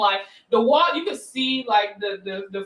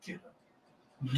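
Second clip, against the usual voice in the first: much softer, low mean command; hissed order; 10 semitones lower, 14 dB quieter than usual. Retold or imitated. Telling the fallen kid to get up, low and mean. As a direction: mutter it meanly. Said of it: "I was like".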